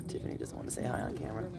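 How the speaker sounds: murmured aside, breath only; whisper. Real, not acted. Owner whispering about shy Tiffany to viewers. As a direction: whisper it so the shy dog isn't startled.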